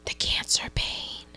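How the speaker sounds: hushed whisper. Real, not acted. Telling a friend the big reveal, in a hushed whisper. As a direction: drop to a dramatic whisper.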